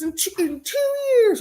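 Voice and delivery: high-pitched mocking wail; hammed-up alarmist falsetto; mock doomsayer. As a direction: mimic panicky prophets in a high voice. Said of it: "I heard people say this".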